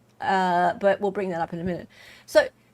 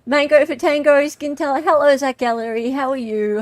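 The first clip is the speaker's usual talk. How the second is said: loud greeting; 6 semitones higher, 7 dB louder than usual. Real, not acted.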